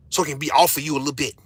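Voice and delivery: ordinary delivery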